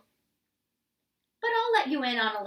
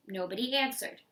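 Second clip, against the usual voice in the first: plain reading voice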